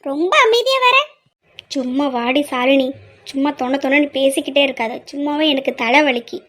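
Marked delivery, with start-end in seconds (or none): 1.8-6.4 low, slightly subdued brush-off; weary irritation. Acted. Headachy woman to her chatty sister, wearily irritated. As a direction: shut down the chatter in a low, tired voice.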